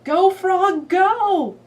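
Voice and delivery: high, loud cheer; root for the frog; excited cheer. Shown as a demonstration. Cheering in story.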